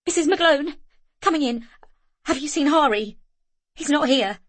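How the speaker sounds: high, loud and urgent; panicked. A narrator's reading. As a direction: rush the words out in fear. Panicked search for missing child.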